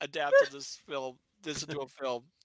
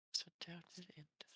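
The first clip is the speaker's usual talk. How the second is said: whisper; 17 dB quieter than usual. Real, not acted. Trying to recall, unvoiced.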